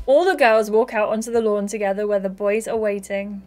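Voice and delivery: ordinary talk, nothing marked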